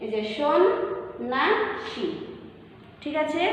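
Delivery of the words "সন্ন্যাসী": "সন্ন্যাসী" broken into shon, naa, shi. Shown as a demonstration. Teacher shows the English-like sh, slow separate syllables. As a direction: pause between syllables of "সন্ন্যাসী", starting with sh. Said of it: "এসএইচ এর মতো উচ্চারিত হচ্ছে".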